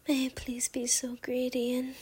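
ordinary talk

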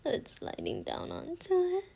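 usual speaking voice

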